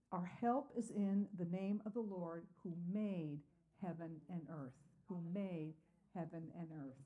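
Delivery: quiet, fading repetition of verse eight; scripture reading. Acted. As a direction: let it soften at heaven and earth. Reads verse eight, repeats.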